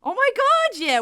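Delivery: fed up and shouting; exasperated outburst. Real, not acted. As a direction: burst out in disbelief.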